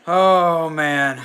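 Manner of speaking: sudden big "oh man!"; loud excited shout. Real, not acted. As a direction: blurt it out loud, thrilled.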